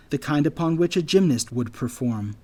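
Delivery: plain reading voice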